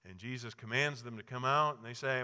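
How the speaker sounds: ordinary delivery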